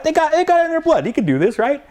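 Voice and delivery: very high-pitched, impassioned insistence; worked-up retold pep talk; Italian-style fervor. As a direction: send the voice way up, rallying a doubter. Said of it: "That's the Italian in me coming out".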